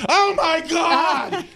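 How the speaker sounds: put-on high, slow, yelled "Oh my God" from a frightened old woman; a scared, stretched-out cry way up high; startled mom imitation. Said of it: "And she goes".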